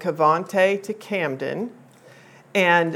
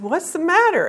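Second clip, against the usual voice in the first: alarmed question; 11 semitones higher than usual. Retold or imitated. Mother asks crying child.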